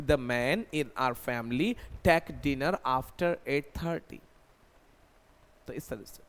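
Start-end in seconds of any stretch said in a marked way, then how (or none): 0.0-4.2 very slow model sentence; slow dictation. Shown as a demonstration. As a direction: set out each word for the class. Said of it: "इस तरीके से बोल सकते हैं कि". (धीरे उदाहरण वाक्य)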